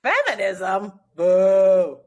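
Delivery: loud, jeering mom's boo; mocking boo. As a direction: boo it loudly like a disapproving mom. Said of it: "She's gonna be like".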